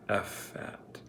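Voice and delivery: unvoiced whisper sounding out "F at". A narrator's reading. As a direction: breathe it out, testing the sounds. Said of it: "Zero whispered".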